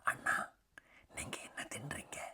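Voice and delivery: put-on little brother whispering to his older brother; hushed question. A narrator's reading. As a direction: whisper it so nobody else hears. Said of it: "அவன் மெதுவான குரலில் கேட்டான்".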